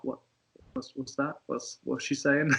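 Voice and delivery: very soft, under-the-breath mock aside; discreet question. Partner nudging for a translation, hushed. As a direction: keep it hushed, as if asking on the sly.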